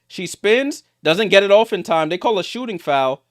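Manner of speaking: high, urgent game call; excited play-by-play. Real, not acted. Commentator calls a spin as time runs out, excited. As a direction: race the shot clock in your voice. Live play-by-play at shot clock.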